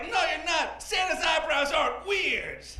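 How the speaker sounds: mock childish protest; upset first grader. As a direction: imitate a little boy crying foul. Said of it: "immediately starts crying".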